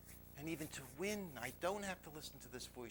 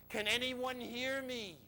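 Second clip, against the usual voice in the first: high, drawn-out call for help; crying out; 8 semitones higher, 3 dB louder, slower than usual. Retold or imitated. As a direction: call slowly across a mountain, acting it out. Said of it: "and then he cries".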